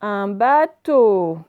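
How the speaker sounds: "Un bateau" slow and loud, syllables stretched. Shown as a demonstration. Teacher showing O at the end, syllable by syllable. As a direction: draw out "teau" at the end of "bateau". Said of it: "il est tout à la fin".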